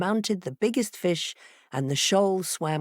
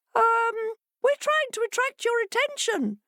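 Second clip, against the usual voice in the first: much higher, hesitant fish voice; fishes replying. A narrator's reading. Fishes answering the curious fox, high and hesitant. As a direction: squeak it out a bit sheepishly. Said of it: "the fishes replied".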